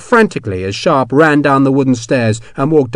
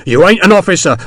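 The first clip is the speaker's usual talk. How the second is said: higher, louder protest; angry protest; 7 semitones higher, 6 dB louder than usual. Acted. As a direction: shout it back defiantly. Hexwell protesting.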